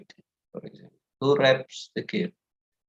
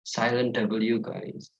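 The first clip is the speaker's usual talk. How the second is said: ordinary talk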